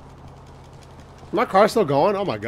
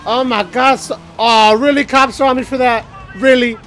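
loud outrage; 9 semitones higher, 6 dB louder than usual. Real, not acted. Cops chase player for nothing.

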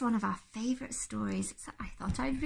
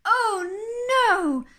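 loud, high, stretched wail; exasperated Mister Bear; 9 semitones higher, 12 dB louder than usual. Acted. Sleepless bear hears aeroplane noises, loud exasperated wail. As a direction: cry it out in dismay.

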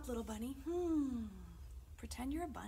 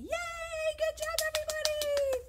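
very high, drawn-out squeal of praise; excited cheer; 7 dB louder than usual. Real, not acted. Leader cheering everybody after the birthday song, high excited squeal.

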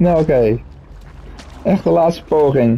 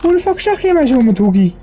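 outraged; 9 semitones higher than usual. Real, not acted. Outraged at game.

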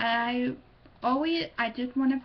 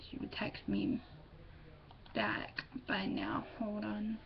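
unvoiced mutter; 9 dB quieter than usual. Real, not acted. Muttering while waiting.

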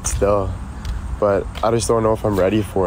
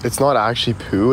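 usual speaking voice

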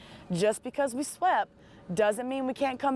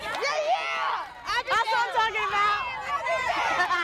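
joyful celebration; 10 semitones higher, much slower than usual. Real, not acted. Coach celebrating the winning run with the team, ecstatic. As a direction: celebrate with everything you've got.

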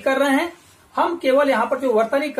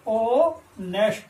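Honest drawn out slowly, "o-nest"; slow demo; 2 semitones lower, much slower than usual. Shown as a demonstration. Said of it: "मैं लिख देता हूं हिंदी में".

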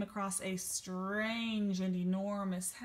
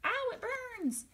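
high squeal of pain; slower than usual. Acted. Goldilocks burns mouth.

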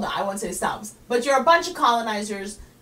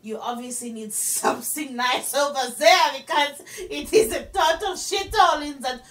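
mock French giver; 3 semitones higher than usual. French giver looks down on New York, mock French accent. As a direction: sneer in a hammed-up French accent. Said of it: "They're just like".

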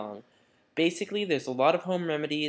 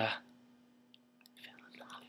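whisper; 17 dB quieter, much slower than usual. Acted. Dreamy memory of [name].

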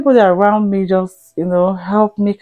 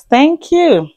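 higher, louder playful thanks; big thank you; 9 semitones higher, 5 dB louder than usual. Acted. Thanking new subscribers, higher and louder with playful punch. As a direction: throw the thanks up high and loud.

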